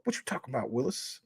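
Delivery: a soft, hushed try at Arnold's line; imitating Arnold. Streamer quietly retries the Arnold catchphrase for chat. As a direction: quietly mimic the kid's catchphrase. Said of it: "I can't do it perfect".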